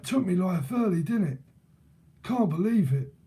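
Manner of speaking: mock London gaffer in disbelief; imitated London accent. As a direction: lament it in a put-on London accent. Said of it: "someone from London".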